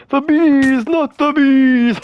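high, dragged-out panicked wail; Cortex voice line. Retold or imitated. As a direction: wail it like a doomed movie hero. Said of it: "the most Cortex, you know, voice line ever".

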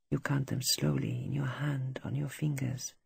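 whispered, barely voiced ramble; under his breath. Wounded soldier rambling to himself in a hushed whisper. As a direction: murmur it to yourself, half asleep. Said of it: "speaking softly under his breath".

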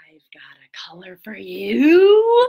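slow breathy secret whisper; teasing whisper. Acted. Teacher teases kids about the next wig color, whispered.

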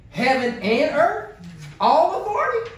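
rising, emphatic rhetorical question; incredulous challenge. Real, not acted. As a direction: throw the verse back as a question, pitch climbing. Repeats verse as challenge to congregation.

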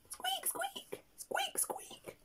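tiny, very high, soft squeaks; mouse squeaking. Acted. Story reader answering what the mouse says, tiny high-pitched squeaking. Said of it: "What does the mouse say?".

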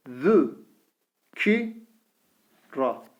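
Vü said with thin Ü, each syllable drawn out; syllable drill with thin vowel. Shown as a demonstration. Teacher reads the thin-vowel syllables to students, slowly. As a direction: read out each syllable apart. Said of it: "Zel harfi ince harf".

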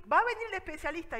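high and loud announcement; announcing loudly. Retold or imitated. Doctor announcing the specialist, loud and high. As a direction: call it out to the room. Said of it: "Y yo así".

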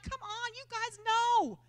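a lightly voiced, exasperated apostle; exasperated Peter. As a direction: brush off the drunk accusation in disbelief. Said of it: "he was like".

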